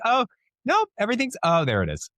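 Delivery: put-on high sing-song Minnesota accent; imitating a Minnesota accent slipping out. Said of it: "your Minnesota accent comes out".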